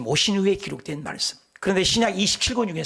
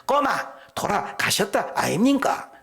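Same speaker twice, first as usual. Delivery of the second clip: Gyeongsang dialect; 5 semitones higher, slower than usual. Retold or imitated. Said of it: "경상도 사람은".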